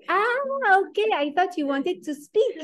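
light, surprised lady; waitress finally understands, surprised; waitress realizing. Retold or imitated. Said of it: "lady answers".

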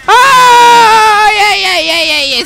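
long, high, very loud scream; real gamer panic, falling from its peak; panicked scream. Real, not acted. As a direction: scream out, tumbling into frantic refusals. Near disaster in the game.

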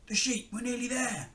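high crook's voice; put-on voice of a storybook thief; high-pitched villain closing in on the prize; thief Len. A narrator's reading. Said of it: "said Len".

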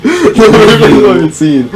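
laughing. Real, not acted. Laughing repeat of slogan.

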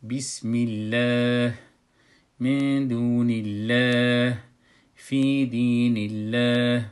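slow, loud recitation with a light L in الله; thin, fronted "llaah", held long; measured tajweed demo, light L after kasra; soft, light Allah. Shown as a demonstration.

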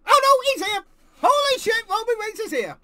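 loud high stunned yell; excited shout. Real, not acted. Fan yelling at the screen as the chief arrives, excited shout. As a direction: yell it in disbelief. Surprise appearance.

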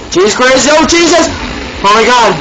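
real panic, begging it away; panicking gamer. Real, not acted. Chased by Slenderman, screaming.

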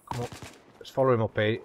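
usual speaking voice

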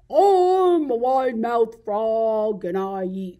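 slow, loud, drawn-out frog voice; wide-mouthed frog. Acted. Frog introduces himself to an alligator, big frog voice. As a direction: launch the boast big and stretched. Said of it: "an alligator".

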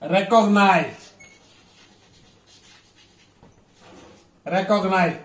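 recognize with REC stressed; teacher's demo of recognize, REC strongest; first-syllable stress. Shown as a demonstration. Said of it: "if R E sound first syllable".

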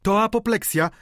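high, loud alarm; cried out. A narrator's reading. Old maid announcing apoplexy, high loud cry. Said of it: "krzyknęła panna Michonu".

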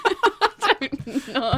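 laughing through the words. Real, not acted. Chatting with Tom, laughing at her own awkwardness. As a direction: giggle it out, loud.

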